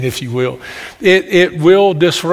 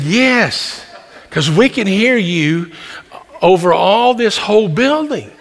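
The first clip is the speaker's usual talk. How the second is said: higher-pitched imitation of a complaint; mock scolding, pitched up; lightly voiced spa lady; 5 semitones higher than usual. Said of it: "And they said".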